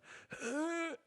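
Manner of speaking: sharp high gasp for air; real gasp for breath; breathless gasp. Real, not acted. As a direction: suck in air, winded from talking. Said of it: "losing my breath".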